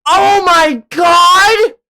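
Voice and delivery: a drawn out real shriek; real shocked scream. Real, not acted. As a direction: scream at the reveal. Stunned by game twist.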